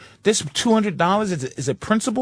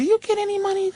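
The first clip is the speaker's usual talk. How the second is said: much higher, fast quizzing; mock incredulous; 11 semitones higher, faster than usual. Quizzing the AT&T rep, high incredulous. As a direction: ask it with mock disbelief.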